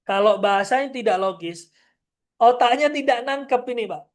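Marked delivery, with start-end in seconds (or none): none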